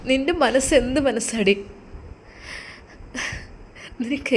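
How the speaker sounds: soft, choked voice of a betrayed husband; sobbing reproach. Acted. Husband speaking to his wife's photo, softly choked with grief. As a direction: hold back tears as you blame her. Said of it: "അവൻ വിങ്ങിപ്പൊട്ടി".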